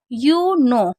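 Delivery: "You know" said clearly with a falling close, the k silent; model pronunciation. Shown as a demonstration. Teacher models "You know" for the class, silent k. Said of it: "यानी कि नो".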